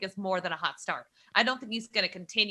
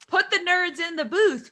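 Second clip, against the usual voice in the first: excited; 9 semitones higher, 4 dB louder than usual. Real, not acted. Repeated quip, high and loud.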